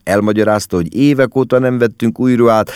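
ordinary delivery

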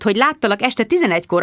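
ordinary delivery, nothing marked

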